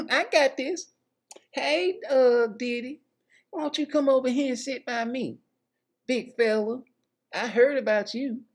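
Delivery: high falsetto flirty woman. Retold or imitated. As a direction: coo it up high like a flirting diva. [name] voiced by male comic.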